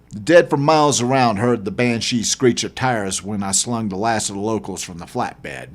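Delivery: plain reading voice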